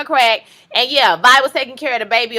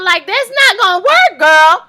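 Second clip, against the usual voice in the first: sassy dismissal; 7 semitones higher, 7 dB louder than usual. Real, not acted. Scolding Darla, sassy dismissal.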